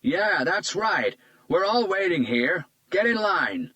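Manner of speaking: put-on an old patient fed up with waiting, complaining out loud; elderly man protesting. A narrator's reading. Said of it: "came a protest from an elderly man".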